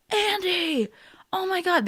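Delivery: very high mock squeal of hello; gushing greeting. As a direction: imitate arriving guests fawning over their friend. Mimics guests greeting Andy.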